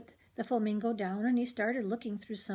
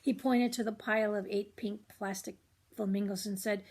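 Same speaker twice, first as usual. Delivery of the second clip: plain reading voice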